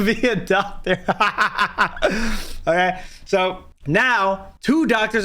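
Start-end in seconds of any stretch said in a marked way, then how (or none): 1.1-2.6 a burst of open laughter; real laugh. Real, not acted. Joking to viewers that the doctor used ChatGPT, laughing.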